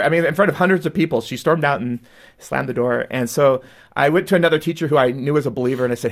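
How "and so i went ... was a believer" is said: usual speaking voice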